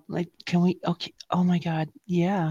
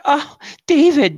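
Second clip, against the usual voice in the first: higher, louder, slow swoon of a name; mock passionate moan; 10 semitones higher, 7 dB louder, slower than usual. Moaning the wrong name to a partner, mock passionate. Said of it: "you're like".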